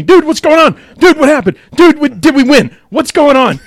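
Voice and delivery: high, loud, frantic questions; panicked friend begging for news; frantic texts. Retold or imitated. Friend texting from a silent pub, frantic high questions. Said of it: "He's texting me".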